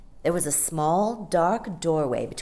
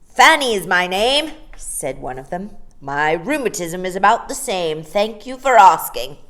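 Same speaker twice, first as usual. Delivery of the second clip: mournful; 3 semitones higher, 10 dB louder than usual. Acted. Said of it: "She spoke very mournfully".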